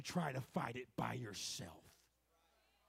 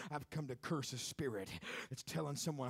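normal talking voice

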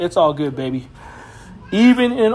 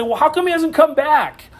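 high mocking question; scoffer's mock; mocking skeptic; 9 semitones higher than usual. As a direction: mimic a scoffer's jeer. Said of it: "People that wanna mock Jesus".

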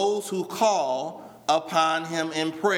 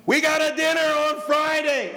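mock shouted announcement; 7 semitones higher than usual. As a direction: bellow the announcement like the whole room is deaf. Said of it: "they're shouting".

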